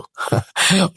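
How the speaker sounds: short, light laugh; dismissive chuckle. Acted. Father-in-law laughs it off. (হালকা করে হেসে উড়িয়ে দেওয়া)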